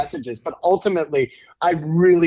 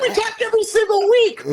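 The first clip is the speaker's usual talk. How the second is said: very high-pitched comic retort; exaggerated high-voiced comeback; mock protest to wife. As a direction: squeal back in mock defensiveness. His acted retort to his wife.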